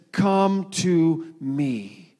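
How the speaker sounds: drawn out very slowly and tenderly; a slow, tender call to come; tender invitation. Acted. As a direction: stretch each word, a gentle welcome. Quoting Jesus' invitation.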